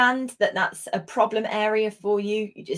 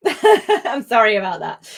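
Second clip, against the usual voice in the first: laughing; 3 semitones higher, 8 dB louder than usual. Real, not acted. Laughing at own slip.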